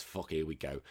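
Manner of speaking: normal talking voice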